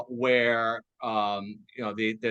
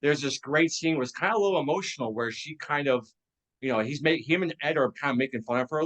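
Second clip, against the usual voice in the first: ordinary talk, nothing marked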